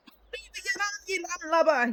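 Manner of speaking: high, loud mock pirate yell; a kid playing pirate, shouting high; little boy waving a stick at a stranger, shouting pirate-style. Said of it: "shouts with an equally pitiful and adorably put-on accent".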